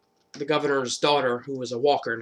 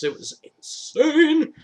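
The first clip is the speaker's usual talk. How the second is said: much higher, slow drawn-out excited cry; thrilled; 5 dB louder, much slower than usual. Real, not acted. Fan gushing over the season, excited. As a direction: gush about the season. Enthusiastic praise.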